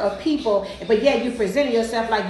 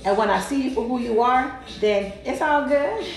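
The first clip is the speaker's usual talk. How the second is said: ordinary talk, nothing marked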